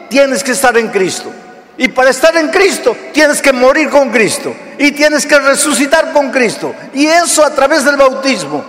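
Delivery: emphatic sermon cadence; preaching. Acted. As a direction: build conviction line by line. Preaching with rising force.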